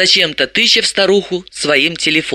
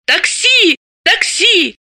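high-pitched hailing cries; shrill-pitched street yell; yelling for taxi; 12 semitones higher, slower than usual. A narrator's reading. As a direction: cry out high to flag a car. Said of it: "с воплями".

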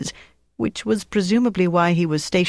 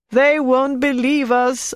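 frightened moaning; 9 semitones higher, slower than usual. A narrator's reading. Antoinette to Flick, dreading the Gestapo: high slow moaning. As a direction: moan it out in dread of the torture. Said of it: "Antoinette moaned".

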